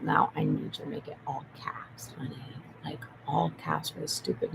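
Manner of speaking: hushed conspiratorial aside; whisper. Real, not acted. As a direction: drop to a whisper for a secret aside.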